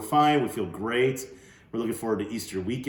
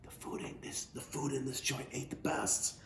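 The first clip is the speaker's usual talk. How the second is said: breathy conspiratorial whisper, slowed down; stage whisper; 5 semitones higher, 10 dB quieter than usual. Acted. Old man teasing Bud about the food, mock whisper. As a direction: let Bud in on a secret in a whisper. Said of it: "acted like he was whispering just to me".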